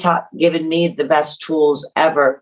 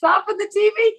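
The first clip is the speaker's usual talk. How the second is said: much higher, playful mother's line; high mock mom voice. Teasing Jenny by echoing her mother, much higher. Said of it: "Did your mother say that".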